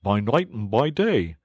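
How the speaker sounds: a vexed small creature grumbling; Mole's complaint. Acted. Mole grumbling to friends about the stoats, high and fussy.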